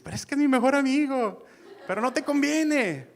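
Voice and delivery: voz aguda de hijo que protesta; hijo quejoso. Retold or imitated. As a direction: protesta lastimero, más agudo. Hijo defiende a su amigo, quejoso. (hijo quejándose con voz aguda ante su madre)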